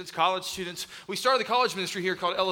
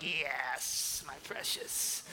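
hoarse breathy creature whisper, much softer; Gollum voice; 5 semitones lower, 9 dB quieter, much slower than usual. Retold or imitated. OCD people purring over perfect carpet lines, creature whisper. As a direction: purr it like a creature hoarding treasure. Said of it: "All you O C D people are like".

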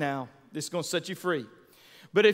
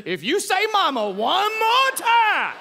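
high, loud fed-up mom; mock mom threat; 8 semitones higher, 3 dB louder than usual. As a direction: threaten like a mom at her limit. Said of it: "have ever said".

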